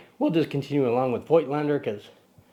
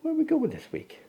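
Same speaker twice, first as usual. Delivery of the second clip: high playful question. Real, not acted. Dad asking his little son, high and playful. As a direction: lift it way up for the little one.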